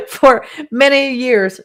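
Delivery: a real choked laugh-cry, slow; teary, slowed, half laughing; laughing through tears. Real, not acted. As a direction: laugh while holding back tears. Choked up thanking friend.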